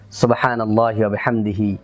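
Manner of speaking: low, even Arabic du'a; closing prayer recitation. Real, not acted. (নিচু স্বরে দোয়া পাঠ)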